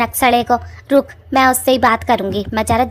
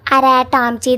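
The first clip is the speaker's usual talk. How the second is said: normal talking voice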